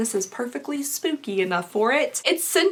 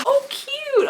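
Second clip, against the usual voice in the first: excited. Real, not acted. Delighted at an unboxed item.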